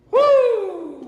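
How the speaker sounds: a big high whooping woo to loosen the throat; loud high woo. Shown as a demonstration. Singing teacher loosening the class's throats with a loud woo. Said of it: "Do what I do and say".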